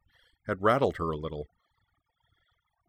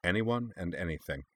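softer, grave and steady; mean every word; understated grave answer; perfect seriousness; 5 dB quieter than usual. Acted. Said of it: "with perfect seriousness".

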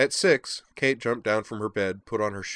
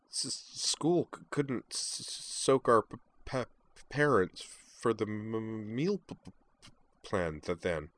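slow, halting, stammered reply; stuttering Kate; 2 semitones higher, 3 dB quieter than usual. Acted. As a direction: stumble and restart on each word. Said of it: "Kate's stutter".

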